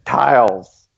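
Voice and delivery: put-on Pittsburgh-accented vowel, "tiles" for towels. Host making fun of people from Pittsburgh, mock Pittsburgh accent. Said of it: "you say instead of towels".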